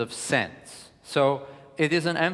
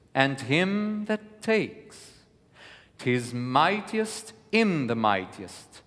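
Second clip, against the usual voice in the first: higher and slow, leaning hard on the accented words; emphatic verse reading; 4 semitones higher, 3 dB louder, slower than usual. Shown as a demonstration. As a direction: read Shakespeare slowly, marking each small accent. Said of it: "So I will begin".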